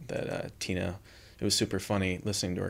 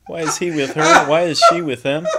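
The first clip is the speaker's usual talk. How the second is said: mock baffled gossip; 10 semitones higher, 14 dB louder than usual. Mocking puzzled onlookers.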